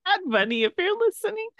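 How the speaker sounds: high-pitched playful appeal to a star; sing-songy begging in jest; mock plea. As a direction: plead jokingly, voice climbing very high.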